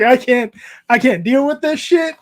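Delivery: hammed-up father at his wit's end; mock exasperation. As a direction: throw up your hands at the kid. Exasperated reaction to his son.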